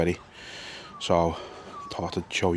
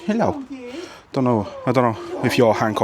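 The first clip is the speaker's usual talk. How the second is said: talking to a dog; 5 semitones higher than usual. Real, not acted. Greeting a dog at kennels.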